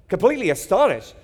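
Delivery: astonished retelling with energy; excited. Shown as a demonstration. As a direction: punch it with amazement. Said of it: "I would have picked a different word".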